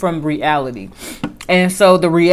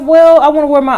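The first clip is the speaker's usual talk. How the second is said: raised, louder and firmer, pushing back; insistent pushback; 8 semitones higher, 6 dB louder, faster than usual. Retold or imitated. Telling the saleswoman what she wants, louder and insistent. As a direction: stand your ground with the saleswoman. Arguing with saleswoman over clothes.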